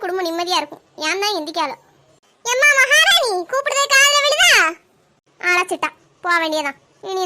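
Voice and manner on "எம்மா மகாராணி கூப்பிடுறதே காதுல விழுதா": sarcastic calling out. Acted. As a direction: summon her with a mocking shout. Mother-in-law calls out sarcastically.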